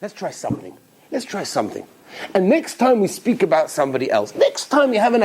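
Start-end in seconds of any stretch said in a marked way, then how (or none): 0.0-1.9 much softer, down-to-earth; quiet sincere resolve. Real, not acted. Preacher urging listeners to change themselves, softly. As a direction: bring it right down, intimate. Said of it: "All right, you know what?".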